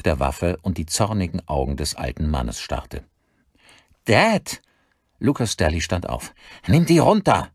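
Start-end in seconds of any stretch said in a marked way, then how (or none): 4.1-4.6 high, loud cry of alarm. Acted. Son calls out to his armed father, high alarmed cry. 6.7-7.5 high, loud urgent demand; urgent shout. Acted. Son urges gun down.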